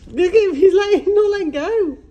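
high, loud delight; excited. Real, not acted. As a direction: squeal it with delight. Excited watching baby.

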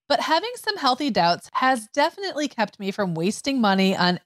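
upbeat loud sponsor read. Acted. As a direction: sell it like a podcast ad. Reads sponsor ad.

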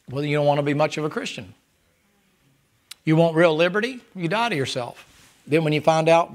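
usual speaking voice

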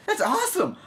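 high-pitched gush of delight; excited. Real, not acted. Vlogger gushing to viewers about a machine gun social, excited squeal. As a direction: squeal it like you can't believe your luck.

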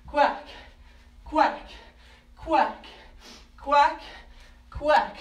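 loud, punchy duck quacking; duck quacks. Pretend. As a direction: quack out loud to get the kids quacking along. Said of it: "Let me hear your quacks".

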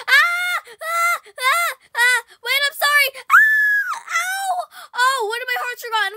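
high loud yelps of panic; yelling as the hits land; panicked screams. Real, not acted. Player being attacked by the mother, screaming in panic.

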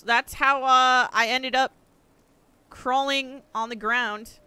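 normal talking voice

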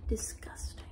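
unvoiced whispered aside. Real, not acted. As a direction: breathe it under your breath.

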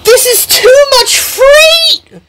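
very high distressed complaint; panicked cry. Acted. Jackie overpowered by the freight, crying out in panic. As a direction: cry out as if overwhelmed by the load.

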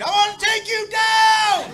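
high, slow hammed-up yell; buddy charging at her, screaming a challenge; mock battle scream. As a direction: charge in with a mock war cry. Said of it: "he screamed".

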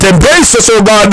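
normal talking voice

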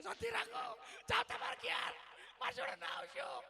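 high, loud scolding yell; mock shout. Neighbours yelling at the crying widower, high mock shout. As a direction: shout him down to stop crying.

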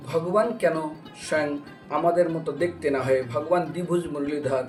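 ordinary talk

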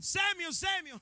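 high, loud call of a name. Acted. God calls the boy Samuel in the night, calling out. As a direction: call out sharply to wake someone.

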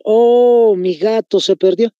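demonstrated lament, high long OH; full of feeling. Shown as a demonstration. Teacher repeating the example for students, emotive exclamation. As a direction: stretch a high OH, then carry on with emotion. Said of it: "estamos llenando a las palabras de sentimiento".

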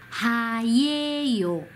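はえよ very slowly as "haa-yeh-yo"; 発音のお手本. Shown as a demonstration. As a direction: stretch each syllable of はえよ for learners. Teacher models a Korean word. (はえよをゆっくり伸ばして読む手本)

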